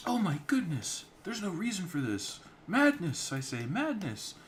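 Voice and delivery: very high, loud and flustered; a fussy innkeeper wringing his hands; fretful innkeeper. Acted. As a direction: falsetto outrage at the chaos. Innkeeper frets over the brawl.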